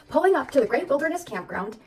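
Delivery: ordinary delivery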